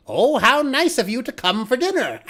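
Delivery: high sly witch voice with a laugh in it; a gleeful witch welcoming her dinner; wicked witch. Acted. Witch greets trapped hero.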